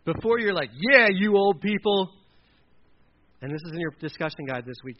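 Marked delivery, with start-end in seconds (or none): 0.7-2.1 loud, drawn-out mock dismissal; loud, slow jeer at elders; mocking retort. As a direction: draw it out like a cheeky youngster. Mocking younger person's retort.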